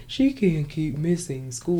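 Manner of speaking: slow, stiff mocking of a petty principal; lightly voiced snooty principal, drawn out. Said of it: "the freaking asshole principal is like".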